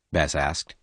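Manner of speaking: ordinary delivery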